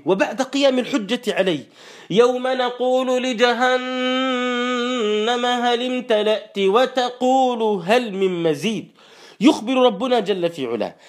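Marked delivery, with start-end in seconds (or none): 2.1-8.9 slow melodic tajweed chant; Quran recitation. Acted. Preacher chanting the verse to the congregation, slow and melodic. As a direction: draw out each verse like a reciter.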